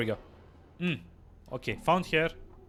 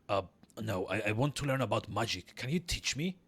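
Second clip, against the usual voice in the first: earnest player character; 2 semitones lower, 5 dB quieter than usual. Acted. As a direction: ask the midwife quietly for lessons.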